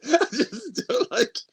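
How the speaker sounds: laughing it out, high-pitched. Real, not acted. Laughing while telling the host about the ex. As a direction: break into a laugh through the words.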